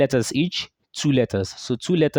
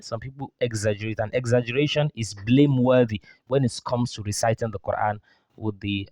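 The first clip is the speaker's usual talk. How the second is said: normal talking voice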